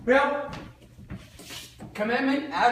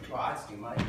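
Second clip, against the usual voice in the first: much lower, softer grumble at the price; balking customer; 11 semitones lower, 5 dB quieter than usual. Acted. A buyer turning down the price, low and put off. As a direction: let the buyer sink down at the cost.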